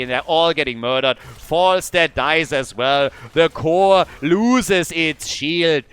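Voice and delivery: loud, slow, punchy caster call; excited play-by-play. Real, not acted. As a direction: ride the fight to the win. Caster calls decisive fight.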